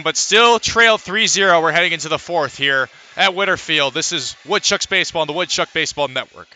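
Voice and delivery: ordinary delivery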